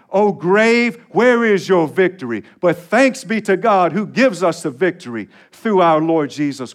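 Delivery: triumphant pulpit reading; fervent scripture recitation. Acted. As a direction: proclaim the verses to the congregation.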